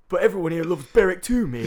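high, loud put-on local fan voice; imitating Berwick fan. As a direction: ham up the cheery Berwick local.

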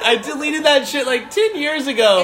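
embarrassed. Real, not acted. Embarrassed defensive.

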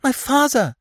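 very high, alarmed cry; panicked exclamation, pitched right up; faint scream. A narrator's reading. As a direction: gasp it out as a small scream. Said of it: "caught her breath on a faint scream".

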